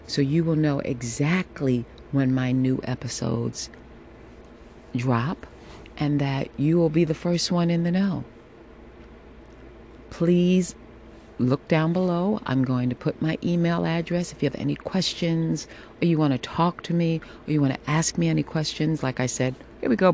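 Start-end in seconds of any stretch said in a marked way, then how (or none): none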